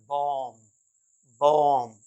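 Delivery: बॉम्ब said "bawm", final B silent; long open "aw", closing on M in बॉम्ब. Shown as a demonstration. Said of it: "बी का sound नहीं होता". (बॉम्ब के अंत में बी चुप, "बॉम")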